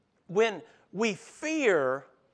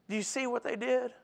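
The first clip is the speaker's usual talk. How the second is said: ordinary talk, nothing marked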